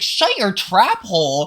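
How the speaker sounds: loud, slow, baffled echo; drawn-out incredulous question; mock incredulous. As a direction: repeat it in exaggerated disbelief. Incredulously repeating counselor's accusation.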